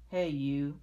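loud, gruff challenge; grouchy ladybug. A narrator's reading. Grouchy ladybug accosting a stag beetle, grouchy. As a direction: call out loudly as a grouchy bug. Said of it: "said the grouchy ladybug".

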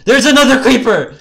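very high, loud warning shriek; panicked scream. Real, not acted. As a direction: scream a sudden danger to the team. Panic at creeper in game.

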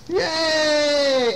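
high, drawn-out cheer; storybook cheer. Acted. Storyteller cheers the hat in the box, delighted.

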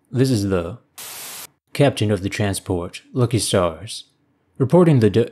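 ordinary delivery

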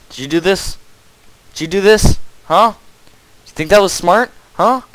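high, loud, disbelieving telling-off; exasperated scolding. Acted. Gamer scolding teammates who blocked the exits, loud and high. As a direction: chew out the careless teammate.

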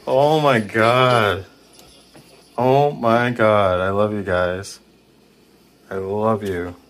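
loud, slow, drawn-out astonishment; overjoyed amazement. Real, not acted. Ghost hunter thanking spirits for a right answer, overjoyed. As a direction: stretch the words out in loud delight.

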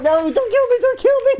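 very high squeaky imitation; mock pleading. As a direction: teasingly mimic a terrified victim, laughing. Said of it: "You're like".

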